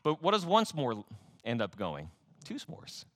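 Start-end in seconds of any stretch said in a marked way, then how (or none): 2.4-3.1 whisper. Acted. Sly aside whisper.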